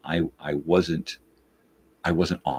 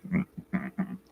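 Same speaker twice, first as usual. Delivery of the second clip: refusing hum; 6 dB quieter than usual. Retold or imitated. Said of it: "And she's like".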